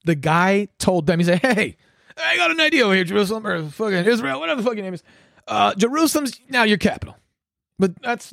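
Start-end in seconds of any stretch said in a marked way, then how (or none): 1.4-7.2 higher-pitched, cocky retelling; mock dealmaker. Retelling Trump bossing Israel around, mock dealmaker. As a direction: pitch it up, tossing out a casual order.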